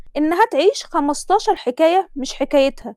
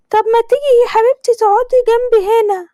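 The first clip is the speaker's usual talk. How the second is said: put-on higher, louder gushing older woman; groom's mother blessing; 10 semitones higher, 6 dB louder than usual. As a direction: lay the blessing on thick, pitched up. (حماة بتبارك بصوت عالي ومبالغ)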